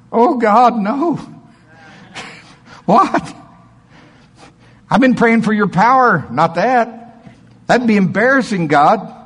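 high, loud, panicked protest to God; a man arguing with God in the prayer line; pleading protest. Retold or imitated. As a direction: beg God to take it back. Preacher replays his protest to God.

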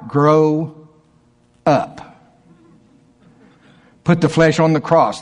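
ordinary talk, nothing marked

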